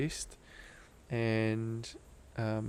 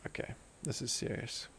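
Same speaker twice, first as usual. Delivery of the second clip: hushed, almost voiceless retold remark; grave undertone; 9 dB quieter than usual. Friend realizing his mate is in trouble, grave and hushed. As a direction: drop to a near-whisper, imitating grave realization.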